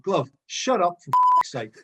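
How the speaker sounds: slow, exasperated shouted telling-off; retold shout. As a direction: imitate a team-mate's irritated yell. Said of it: "Sutty would shout".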